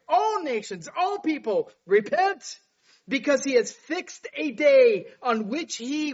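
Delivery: high, loud scripture proclamation; impassioned preaching. Acted. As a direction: preach the verse at full force. Preaches scripture at full force.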